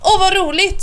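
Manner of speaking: high, gleeful exclamation; delighted child. A narrator's reading. Snurran hears about a hospital stay, gleefully excited.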